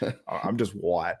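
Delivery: slow, flat deadpan; deadpan reply. Retold or imitated. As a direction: say it slowly and flat. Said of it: "and I'm like".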